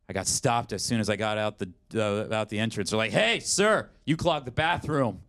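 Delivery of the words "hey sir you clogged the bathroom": calling out. Retold or imitated. Staff calling him out.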